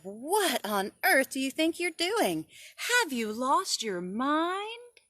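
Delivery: high, loud scolding, slow on the end; scolding mom. Acted. Mom catching her son breaking the window, scolding loudly. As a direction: scold like a shocked mother. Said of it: "she said".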